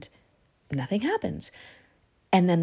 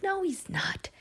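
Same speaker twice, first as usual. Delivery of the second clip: whispered; 14 semitones higher, 4 dB quieter, slower than usual. Acted. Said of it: "They're like".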